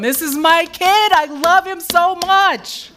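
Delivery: loud, high proud call; God calling out his son, loud and proud; calling out proudly. Retold or imitated. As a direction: announce your kid to everyone. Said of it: "God who's calling out Jesus".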